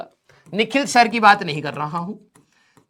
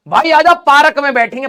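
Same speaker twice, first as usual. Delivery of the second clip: louder call with paa-rak in the local way; mock local buddy; 4 semitones higher, 6 dB louder than usual. Friend inviting him to sit in the park, loud local call. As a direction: hail a friend like a small-town guy.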